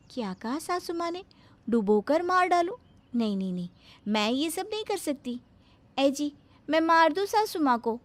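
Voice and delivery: high, syrupy and eager; sugary bahu voice. Acted. Bahu handing her mother-in-law the knife, sweetly eager. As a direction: innocent helpfulness, laid on thick.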